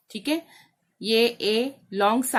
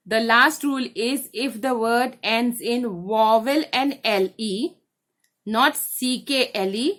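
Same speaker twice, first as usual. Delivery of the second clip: slow dictation; much slower than usual. Shown as a demonstration. Teacher dictates last rule slowly.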